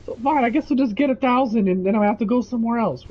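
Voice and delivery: hammed-up grudging customer giving in; miffed customer. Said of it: "She goes".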